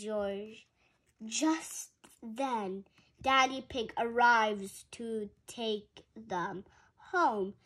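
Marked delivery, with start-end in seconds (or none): none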